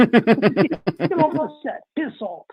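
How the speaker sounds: playful mock British set order; British assistant director. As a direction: drag the words out like a bossy crew boss. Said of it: "an A D over there that said".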